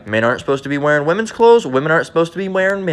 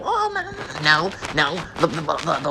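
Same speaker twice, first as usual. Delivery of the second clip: mock flustered objector; 7 dB quieter than usual. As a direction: imitate someone panicking and fumbling for an excuse. Mocks objector to pork law.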